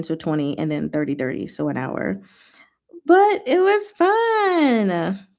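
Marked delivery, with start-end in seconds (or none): 3.0-5.3 drawn-out delight. Real, not acted. Drawn-out high happy line.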